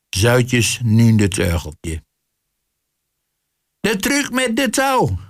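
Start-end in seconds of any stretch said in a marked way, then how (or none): 3.8-5.2 very high-pitched magician's call; high, showman-like announcement; stage cry. A narrator's reading. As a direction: announce the trick high, without extra volume. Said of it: "riep de perverse spril".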